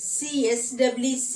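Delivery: ordinary talk, nothing marked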